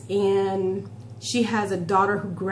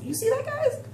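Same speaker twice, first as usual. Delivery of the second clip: playful high squeal. Real, not acted. Showing off her arm muscle.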